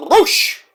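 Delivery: "Rush" as a high "Roosh" with trilled R; rolled-R Roosh. Shown as a demonstration. Host announcing the wrestler's name, "Rush" said as trilled "Roosh". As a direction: trill into "Rush", vowel as in "push" stretched to "oo". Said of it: "some translations it's Rush".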